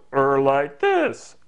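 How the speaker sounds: slow, drawn-out silly voice; comic drawl near normal pitch; funny voice. Shown as a demonstration. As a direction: stretch it out in another goofy voice. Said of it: "I'm now gonna talk like this".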